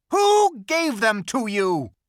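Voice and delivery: high, very loud, slow demand; menacing demand. Acted. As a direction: loom over them and demand it. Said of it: "said the witch".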